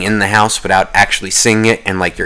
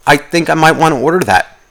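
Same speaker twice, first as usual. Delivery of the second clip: retold musing; 7 semitones higher than usual. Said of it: "let me sit there and go".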